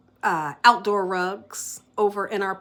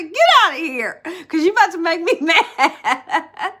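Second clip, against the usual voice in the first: higher, louder laughing disbelief; incredulous laughing retort; 8 semitones higher, 8 dB louder than usual. Retold or imitated. To her husband about the flowers: high, loud, laughing disbelief. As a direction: tease the husband, half laughing. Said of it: "I said".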